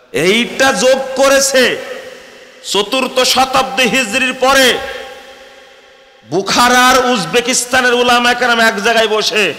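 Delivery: high, loud, fiery sermon peak; preaching at full force. Acted. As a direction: thunder it out to the congregation. (উচ্চস্বরে জোরালো ওয়াজ)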